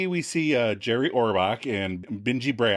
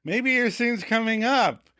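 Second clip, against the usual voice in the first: lightly imitated creepy grin, pitched up; menacing smile; 11 semitones higher than usual. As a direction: smile a little too hard while suggesting. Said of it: "Phoebe suggests menacingly".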